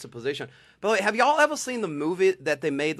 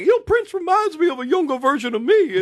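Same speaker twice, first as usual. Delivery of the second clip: high, loud, excited mock boast; 13 semitones higher, 7 dB louder than usual. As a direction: gush it high and loud, full of self-promotion. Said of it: "He's like".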